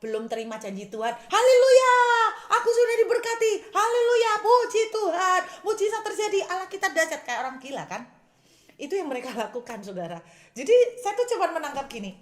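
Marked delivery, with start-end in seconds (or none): 1.2-7.2 high, very loud ecstatic shouting; crazy shouting praise. Acted. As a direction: scream praises like someone gone wild. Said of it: "teriak-teriak seperti orang gila".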